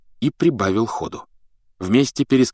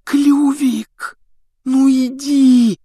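high, strained, drawn-out urging; desperate hiss; 5 dB louder, much slower than usual. A narrator's reading. Harry coaxing the hippogriff to move, desperate. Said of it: "отчаянно шипел Гарри".

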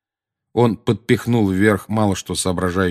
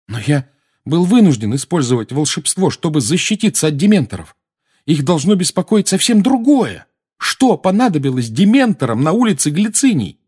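put-on higher, louder indignant boy; heated, raised-voice protest; 9 semitones higher, 6 dB louder than usual. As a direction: argue your case emphatically.

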